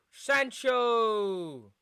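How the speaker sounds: loud excited call; excited shout. Real, not acted. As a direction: shout the name out.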